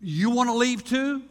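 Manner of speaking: ticked challenge. Acted. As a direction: throw the question at them, fed up. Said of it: "It's not like".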